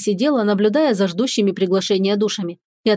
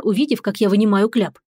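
usual speaking voice

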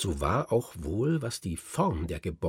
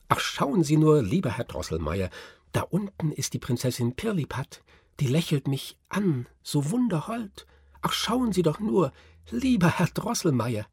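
joyful cry; 7 semitones higher than usual. A narrator's reading. Marie calls joyfully to Herr Drosselmeier, high and bright with delight. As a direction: gush with wonder like a thrilled girl. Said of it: "rief sie freudig".

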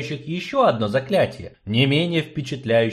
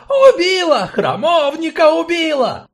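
much higher, much louder frantic shriek; hysterical shriek; 7 dB louder than usual. Acted. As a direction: scream hysterically at the crowd. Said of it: "кто-то истошно завизжал".

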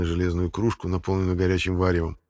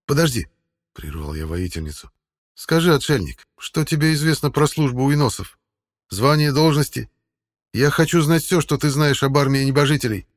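firm demanding voice; 7 semitones higher, 6 dB louder than usual. A narrator's reading. Hero demands answers.

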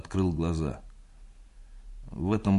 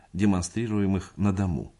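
usual speaking voice

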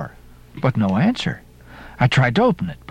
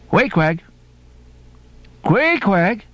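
rising calls through a locked door, higher on the second; calling out; 7 semitones higher, slower than usual. Acted. Ishmael calling to Queequeg through the door, calling out.

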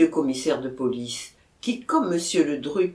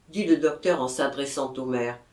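ordinary delivery

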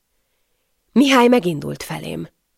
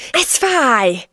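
sudden high yelp of pain; pained hiss; 7 semitones higher, 3 dB louder than usual. A narrator's reading. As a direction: cry out sharply in pain. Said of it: "szisszentem fel". (fájdalmas felszisszenés)

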